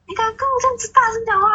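high, fast mock scolding of a pupil; teacher berating a student for talking back, mock angry; imitating angry teacher. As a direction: play the teacher, scandalised and shrill-pitched at speed. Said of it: "他就说".

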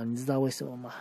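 plain reading voice

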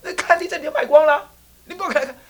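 put-on high, loud angry old man; old man yelling at the visitor from a distance; scolding from afar. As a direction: shout him off the land. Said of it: "远远看到那家伙就骂".

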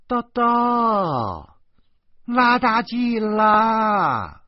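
high, drawn-out call across the house. A narrator's reading. Yan Ming calling Doudou into the room, loud and high. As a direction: sing out his name, loud and high.

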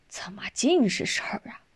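sighing. A narrator's reading. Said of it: "少元叹口气道".

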